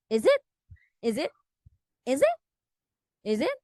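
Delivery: "Is it?" with a buzzed Z for the s; Z pronunciation. Shown as a demonstration. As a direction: say "Is it?" as "izzit", several ways. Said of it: "pronounced as a Z sound instead of S".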